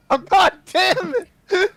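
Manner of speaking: high, loud exasperated cry; real frustration boiling over; frustrated outburst. Real, not acted. Player unable to find intimidation, frustrated yell.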